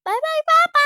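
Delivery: put-on high, loud little child greeting; bright toddler hello to dad. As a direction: chirp it like a small kid. Toddler waves dad goodbye.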